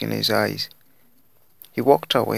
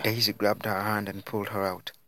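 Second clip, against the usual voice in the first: ordinary delivery, nothing marked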